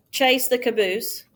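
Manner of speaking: ordinary delivery, nothing marked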